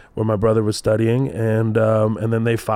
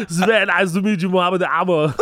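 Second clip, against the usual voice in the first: raised-pitch comic Austrian-accented impression; Arnold Schwarzenegger; 6 semitones higher than usual. Austrian star meeting the guest, hammed-up accent. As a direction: greet formally as an Austrian action star. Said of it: "Maybe Arnold Schwarzenegger".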